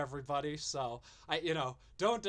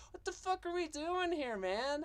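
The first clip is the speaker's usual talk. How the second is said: exasperated outburst; 11 semitones higher than usual. Real, not acted. Exasperated rant at Vito.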